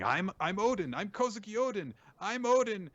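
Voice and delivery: put-on high, small kid voice insisting; lightly high childish insistence. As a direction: play the little boy claiming his name. Child Yamato insisting on name.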